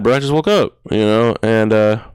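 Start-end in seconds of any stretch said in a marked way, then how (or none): none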